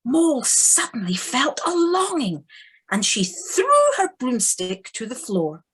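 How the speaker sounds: ordinary talk, nothing marked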